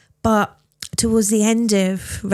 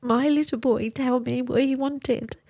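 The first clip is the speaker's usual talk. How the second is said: breaking with grief; 4 semitones higher than usual. Real, not acted. Recalling son's wish, grief.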